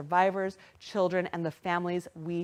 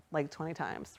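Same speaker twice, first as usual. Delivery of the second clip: ordinary talk, nothing marked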